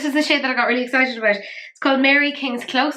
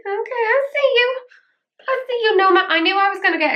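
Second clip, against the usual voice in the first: excited; 11 semitones higher, 4 dB louder than usual. Real, not acted. Greeting a favourite shade, high excited coo. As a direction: coo at the shade like spotting a friend.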